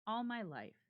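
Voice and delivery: ordinary delivery, nothing marked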